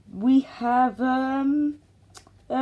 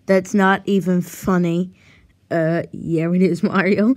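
usual speaking voice

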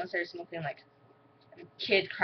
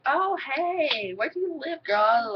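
put-on high, chirpy "hey girl" voice; mock friendly girl; 8 semitones higher, slower than usual. Imitating classmates greeting her. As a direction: gush at a new friend. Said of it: "everyone was like".

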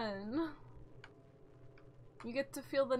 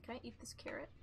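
soft unvoiced whisper; 12 dB quieter, faster than usual. Real, not acted. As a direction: whisper the question secretly. Sudden hushed question.

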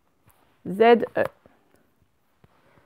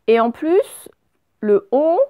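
ordinary delivery

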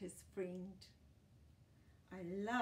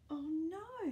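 higher, louder playful alarm; mock dismay; 8 semitones higher, 6 dB louder than usual. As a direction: gasp it out like a shocked storyteller. Dog chases the friend.

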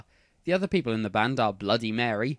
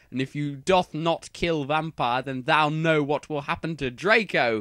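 ordinary delivery, nothing marked

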